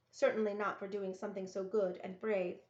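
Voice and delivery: ordinary delivery, nothing marked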